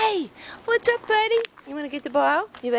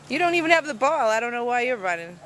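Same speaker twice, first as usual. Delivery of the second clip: ordinary talk, nothing marked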